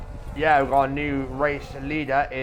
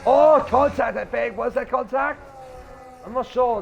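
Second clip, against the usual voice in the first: surprised; 10 semitones higher, 4 dB louder than usual. Real, not acted. Sudden contact call.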